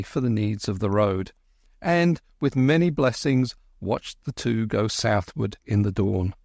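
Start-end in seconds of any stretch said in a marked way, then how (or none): none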